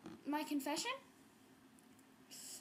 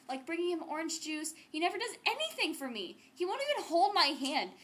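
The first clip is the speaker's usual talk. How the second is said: normal talking voice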